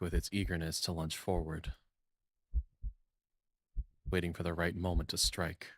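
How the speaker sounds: steady, lightly hushed narration voice; calm second-person narration with a touch of tension; story narration. Acted. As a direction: read the scene like a storyteller building suspense. Reads game narration aloud.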